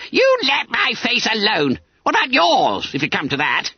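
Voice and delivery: high-pitched schoolboy indignation; indignant. A narrator's reading. Bunter answers back to his form master, indignant. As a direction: squeak back at the master, affronted. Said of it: "He forgot even his dread".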